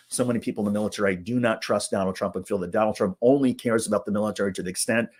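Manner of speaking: ordinary delivery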